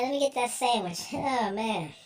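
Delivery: normal talking voice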